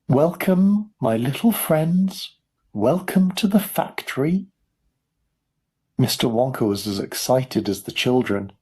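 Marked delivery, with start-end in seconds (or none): 0.1-4.5 high, smiling showman's greeting; crying out welcome. A narrator's reading. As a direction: greet the children grandly, high and lilting upward. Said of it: "smiled at the five children and cried".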